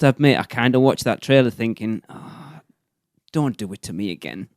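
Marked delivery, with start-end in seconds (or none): 2.1-4.5 breathy whisper of dread; whispered thought. Retold or imitated. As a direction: whisper it to yourself. Inner thought quoted.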